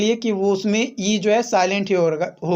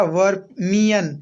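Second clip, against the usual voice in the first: Vermian said slowly and distinctly; slow word for class; much slower than usual. Shown as a demonstration. Teacher introducing the word to students, slowly. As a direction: stretch out vermian so students catch it. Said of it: "teacher giving new word".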